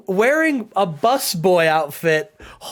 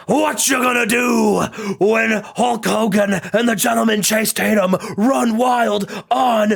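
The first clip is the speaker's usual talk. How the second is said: Hulk Hogan; 4 semitones higher than usual. Acted. Wrestler revived after ripping off his shirt, shouted hype. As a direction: bellow it as a stretched-out hype challenge. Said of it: "he says".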